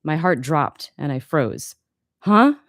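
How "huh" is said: higher, louder startled "huh"; startled confusion. Acted. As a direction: react with sudden bewilderment, pitch jumping up. Student frozen by instructor's remark.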